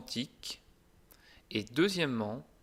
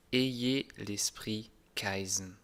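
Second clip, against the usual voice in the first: slow; 3 semitones lower, slower than usual. Shown as a demonstration. Slow intro rule statement.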